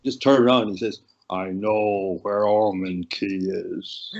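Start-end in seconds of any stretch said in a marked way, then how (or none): 1.3-4.1 drawn-out, low put-down; slow, lowered voice, meant to unsettle; Haughey. Retold or imitated. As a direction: imitate a politician slowly pulling rank. Retold put-down from Haughey in car.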